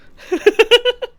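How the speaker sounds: loud, high sudden laugh; real laugh cutting off a word; burst of laughter. Real, not acted.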